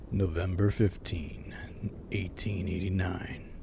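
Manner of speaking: low, slow diary narrator. Acted. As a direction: read it like a grim game narrator. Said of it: "my best impression of, of, of narrator voice".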